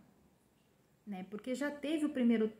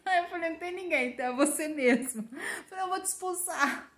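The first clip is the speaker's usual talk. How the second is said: laughing; 6 semitones higher than usual. Real, not acted. Retelling talk with God, laughing.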